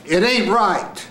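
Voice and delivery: high, slow, emphatic scene line; acted last line. Shown as a demonstration. Coach showing the scene's last line, high and drawn out. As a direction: push it high and draw it out.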